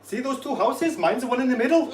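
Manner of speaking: hammed-up Welsh accent, laughing at the end; Welsh joke voice. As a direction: deliver the joke in a sing-song Welsh accent. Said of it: "He's like".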